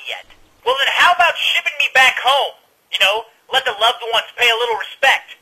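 high-pitched, exasperated rant; indignant complaint. Acted. Irate complaint called to Tucker.

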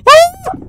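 very high, loud startled scream; shrill scream. Real, not acted. Player jump-scared by the doll, shrill scream. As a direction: shriek in real fright.

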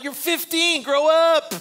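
high, exasperated mock telling-off; exasperated scolding. Onlooker to a tantruming fifteen-year-old in Walmart, exasperated scolding.

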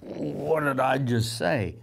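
slow, stunned disbelief at own words; startled at himself. Acted. Peter to himself after his outburst, slow and stunned. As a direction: draw it out, taken aback by yourself. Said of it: "He's like".

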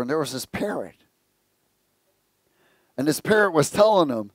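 ordinary delivery

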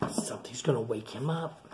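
suspense whisper. A narrator's reading. Telling children the bear will wake, hushed whisper. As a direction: lean in and hush the warning.

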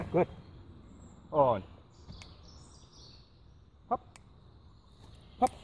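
talking to a dog. Real, not acted. Owner giving dog commands.

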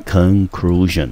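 conclusion drawn out slowly, stress on CLU; slow model pronunciation. Shown as a demonstration. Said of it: "念成conclusion". (慢慢示范念conclusion)

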